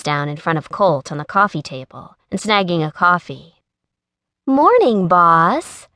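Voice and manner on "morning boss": sugary, high and louder greeting; sweetest voice. Acted. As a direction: sweet-talk the boss to needle him. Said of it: "she said in her sweetest voice".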